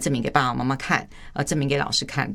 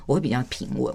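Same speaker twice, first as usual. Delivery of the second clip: ordinary delivery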